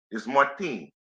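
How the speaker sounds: Martin with a light Spanish accent, stress on the end; Spanish pronunciation of Martin. Shown as a demonstration. Commentator fixing the fighter's name, light Spanish accent. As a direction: give Martin the Spanish sound, mar-TEEN. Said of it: "You have to use that accent".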